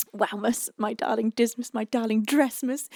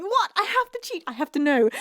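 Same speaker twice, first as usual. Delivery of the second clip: playful protest; 6 semitones higher, 6 dB louder than usual. Real, not acted. Playful protest about cheating.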